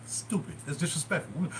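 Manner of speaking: ordinary talk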